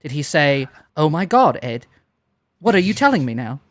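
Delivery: normal talking voice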